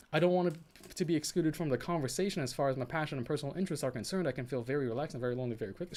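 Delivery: fast, rattled-off reading of her goals; rapid read-out. Shown as a demonstration. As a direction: race through the quoted lines like a list. Rattles off her translated lines.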